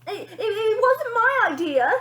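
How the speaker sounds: very high, loud stammering panic; scared father blaming others; frightened splutter. Acted. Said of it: "spluttered a frightened father".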